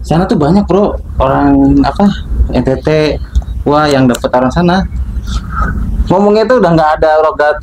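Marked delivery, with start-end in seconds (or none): none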